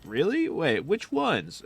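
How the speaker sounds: high, wide-eyed mock astonishment; fake surprise. Trolling the enemy by acting amazed at his claim, high mock surprise. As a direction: play dumb and amazed to bait him.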